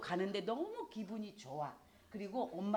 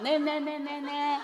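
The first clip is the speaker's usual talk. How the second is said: put-on cheeky child; 9 semitones higher, 8 dB louder, slower than usual. Child mocking mom.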